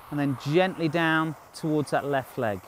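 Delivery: ordinary talk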